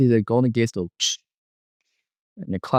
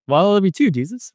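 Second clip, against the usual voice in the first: higher, eager retold reply; excited; 9 semitones higher, 3 dB louder than usual. Replying to Jesus after hearing he is loved, excited. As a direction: blurt it back, pitch jumping up. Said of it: "I got a little excited".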